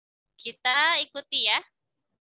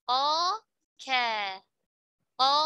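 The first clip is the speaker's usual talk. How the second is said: usual speaking voice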